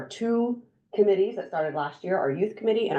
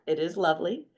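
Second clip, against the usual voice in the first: normal talking voice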